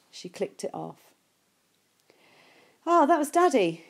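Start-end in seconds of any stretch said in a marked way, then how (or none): 2.8-3.8 mum bubbling over, higher and louder; delighted, can hardly contain it; excited. Acted. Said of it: "she said excitedly".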